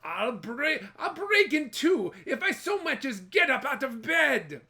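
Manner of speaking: loud, high put-on Irish grumble; whiny lazy husband. Jamie whining loudly to his wife about his back. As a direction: moan about a sore back to get out of work.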